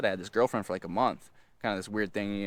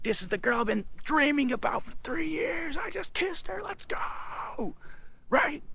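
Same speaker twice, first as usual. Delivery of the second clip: high, strained whisper-yell; hyped mock scream. Teen who just kissed his crush, muted hyped scream. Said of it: "I was so hyped".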